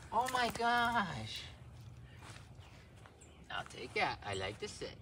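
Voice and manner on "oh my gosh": high, drawn-out slow delight; gushing puppy praise. Real, not acted. Owner gushes at her puppy, high and slow. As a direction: stretch it out like you're melting over him.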